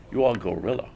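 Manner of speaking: put-on mild Japanese accent, flat statement; deadpan Japanese accent imitation. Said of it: "imitating an accent".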